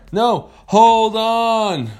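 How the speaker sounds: high, loud, stretched-out holler; desperate yell. Acted. Rider correcting the speeding whale, long frantic holler. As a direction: shout it long over the rushing water.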